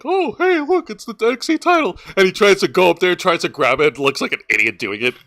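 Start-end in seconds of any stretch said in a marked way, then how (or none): 0.0-2.0 high, excited dumb guy voice; clueless trainee voice. Retold or imitated. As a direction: play a wide-eyed rookie spotting the belt. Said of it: "and he's like".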